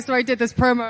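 ordinary talk, nothing marked

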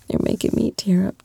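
ordinary delivery

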